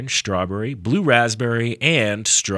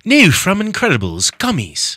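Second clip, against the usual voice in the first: high, drawn-out mock English accent; hammed-up English ad read, pitched well up; 9 semitones higher, 4 dB louder, slower than usual. Shown as a demonstration. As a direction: put on an exaggerated English accent, high and slow. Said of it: "I can do an English accent".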